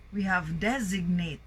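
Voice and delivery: ordinary delivery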